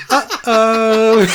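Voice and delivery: high, loud Teletubby voice. Retold or imitated. Teletubby monster heard by the players, toy-like imitation. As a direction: imitate a cutesy Teletubby catchphrase, amused. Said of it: "But it was Poe".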